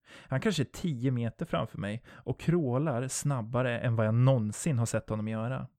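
usual speaking voice

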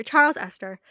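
loud, grand dramatic announcement; dramatic reveal. Acted. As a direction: proclaim "Charles Esther" like a big reveal. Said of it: "I just love the drama of how you said it".

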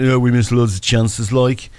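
lightly put-on Birmingham accent, mocking; poor Brummie accent. Mocking Villa fans online in a weak Brummie accent. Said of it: "that was a very poor Brummie accent".

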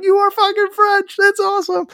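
high-pitched, delighted exclamation; excited mock reaction. Host reacting to the French band's accent, excited high-pitched outburst. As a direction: exclaim it high, like a thrilled fan.

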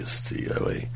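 breathy aside under the breath; whisper. Real, not acted. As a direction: whisper it to the side, unheard. Whispered aside during radio banter.

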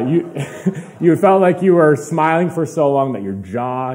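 ordinary delivery

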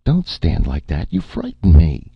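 loud, rising fear; frightened. A narrator's reading. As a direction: plead out of fright. Erica frightened by Martin.

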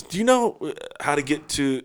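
high-pitched attempt at an Italian stranger; Italian accent. Stranger at the gas station asks the way, Italian accent. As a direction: imitate an Italian asking directions. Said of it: "he's got an Italian accent and everything".

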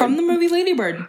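high, put-on echo voice; mock high voice. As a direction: parrot it back in a goofy high voice. Echoing in a put-on voice.

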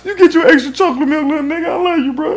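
put-on high, fast doting voice to a little kid; mock doting voice. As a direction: coo at him like a proud big brother. Mock sweet talk to kid.

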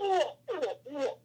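soft hooting elk noises; quiet animal woos; imitated elk call. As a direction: mimic the elk with soft, falling hoots. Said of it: "and then you hear this".

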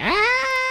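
piercing high pitched scream. Shown as a demonstration. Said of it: "Screaming is just kinda like".

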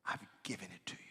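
soft unvoiced whisper. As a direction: breathe it quietly in awe. Whispered repeat of promise.

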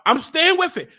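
usual speaking voice

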